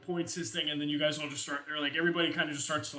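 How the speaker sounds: ordinary talk, nothing marked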